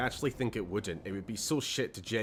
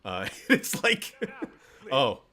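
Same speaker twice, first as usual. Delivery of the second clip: laughing; 4 semitones higher, 7 dB louder, slower than usual. Real, not acted. Laughs at own joke.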